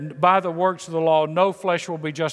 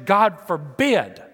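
slow, emphatic mock outcry; retold anguished outcry; 3 semitones higher, 4 dB louder, much slower than usual. Professor explaining Paul's hard term, mildly anguished outcry. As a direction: draw it out in imitated anger and frustration. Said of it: "like screaming out in fear and, and anger and frustration".

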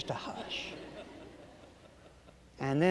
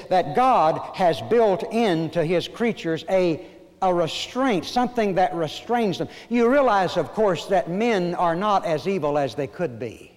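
loud, raised-pitch pulpit delivery; forceful preaching; 4 semitones higher, 6 dB louder than usual. Real, not acted. Preacher explains human restraint to his congregation, loud and emphatic. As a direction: drive the teaching home at full voice.

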